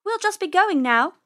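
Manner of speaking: high, loud getaway line; uneasy little rabbit, pitched high; nervous politeness. Acted. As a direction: edge away politely from a predator. Rabbit nervously excuses herself to cat.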